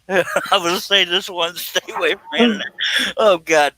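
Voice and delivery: high-pitched mock warning through laughter; laughing hard. Real, not acted. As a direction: quote the threat while cracking up. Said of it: "Did anybody else turn purple laughing".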